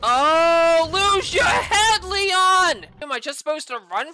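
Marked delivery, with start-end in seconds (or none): 0.0-2.9 loud, drawn-out, high shout; slow, loud rebuke; frustrated yell. Real, not acted. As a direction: holler at the character in exasperation. Yelling at game character who died.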